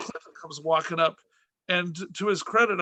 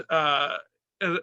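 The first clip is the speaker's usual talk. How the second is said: ordinary talk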